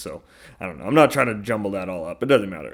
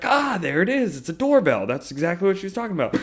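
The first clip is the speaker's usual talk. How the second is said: much higher, excited realization; retold discovery; 9 semitones higher, faster than usual. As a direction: perk up, found it at last. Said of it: "I'm like".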